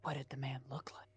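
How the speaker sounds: voiceless, careful question; whispering. Acted. Outsider questions hermit about the killer, whispering. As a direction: press for details in a whisper.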